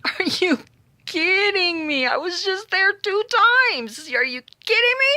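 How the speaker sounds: high, loud disbelief, stretched kidding; can't believe it, half laughing; incredulous. Retold or imitated. Tina to the store clerk on the phone, high incredulous outburst. Phone turned in after two checks.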